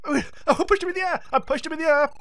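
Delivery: high, quick gleeful exclaim; triumphant glee. Real, not acted. Gamer delighted he shoved the enemy away, gleeful. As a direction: crow with surprised delight. Winning the struggle.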